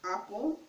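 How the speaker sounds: "apple" said the Igbo way, opening on "ah"; Igbo-style apple. Shown as a demonstration. Teacher shows viewers the Igbo word, "apple" with an "ah". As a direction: say "apple" with an open "ah" first. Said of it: "It's pronounced".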